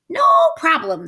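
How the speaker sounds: high, loud witch; brisk, confident hag. Acted. Witch checking her pantry, high and loud. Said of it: "said Gritch".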